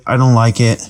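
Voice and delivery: ordinary talk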